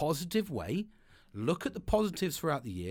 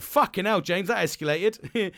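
mock exclamation; 4 semitones higher, 8 dB louder than usual. Acting out the colleague's reaction.

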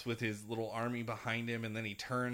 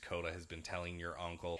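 normal talking voice

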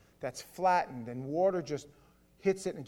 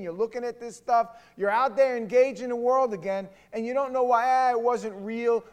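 higher, loud, driving sermon delivery; impassioned preaching; 6 semitones higher, 9 dB louder than usual. Real, not acted. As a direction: press the warning home, building in force. Preaches with building intensity.

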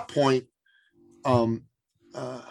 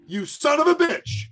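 much higher, slow mock outrage; grudging admiration; 14 semitones higher, 4 dB louder, slower than usual. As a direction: drag it out like a man who has been won over. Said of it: "he goes".

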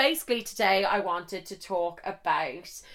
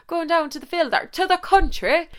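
a slightly better rural Irish accent, pitched higher; Irish country accent; 8 semitones higher than usual. Shown as a demonstration. Describing her trip to the country, mock rural Irish accent. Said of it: "So, to, a bit better".

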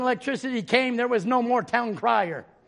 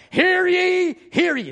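town crier; 8 semitones higher, 4 dB louder, slower than usual. Retold or imitated. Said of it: "A town crier".

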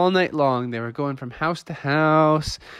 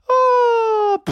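high, loud swooning "oh"; fainting cry; 5 dB louder than usual. Acted. Father Frog faints after blasting, fainting cry. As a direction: wail it, then collapse. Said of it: "Father Frog went".

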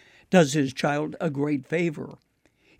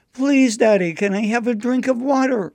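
put-on higher and louder, a small boy begging; pleading little boy; 7 semitones higher, 4 dB louder than usual. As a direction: beg Daddy again like a little boy. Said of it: "another plea".

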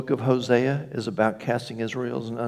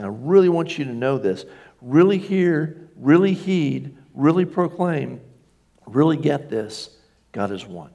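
each REALLY pushed high and strong; God urging, stressing each really; emphatic divine voice; 4 semitones higher than usual. Acted. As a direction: press every really home as God. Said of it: "God is emphasizing".

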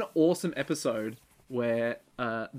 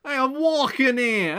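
high, loud, slow exaggerated New Yorker; hammed-up New Yorker; 11 semitones higher, 6 dB louder, slower than usual. Echoing a friend's line as a hammy New Yorker, loud. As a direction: overdo the famous street yell.

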